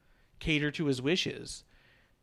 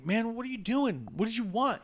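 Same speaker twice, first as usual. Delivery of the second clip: higher-pitched acted disbelief; pitched-up retold demand; retold exasperation; 7 semitones higher than usual. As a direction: imitate pleading, exasperated questions. Acting Roman's exasperated questioning.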